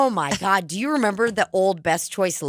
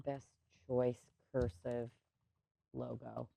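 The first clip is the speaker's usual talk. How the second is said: muttering a search; 5 semitones lower, 18 dB quieter than usual. Real, not acted. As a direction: murmur it under your breath, word by word. Mumbles search words while looking up.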